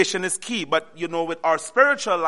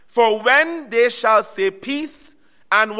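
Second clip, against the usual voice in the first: slow, solemn verse reading; scripture reading; 5 semitones higher, 5 dB louder, much slower than usual. Acted. Preacher reading verse three to the church, slow. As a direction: lean on each word of the verse.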